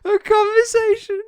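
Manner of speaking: laugh-yell the words; laughing shout. Real, not acted. Bursting into laughter while gaming.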